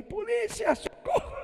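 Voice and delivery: high, thin mock scream for help; mock cry for help. Boy calling for the police as mom grabs him, mock panic. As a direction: squeal it like a scared boy.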